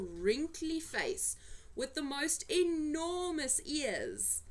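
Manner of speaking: usual speaking voice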